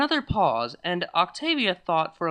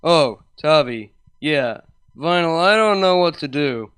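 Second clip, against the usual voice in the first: confused guy; 8 dB louder than usual. Acted. Confused male friend on phone.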